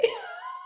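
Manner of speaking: sharp high-pitched laugh of disbelief; high incredulous laugh. Real, not acted. Guest laughing at the principal's remark, high incredulous laugh.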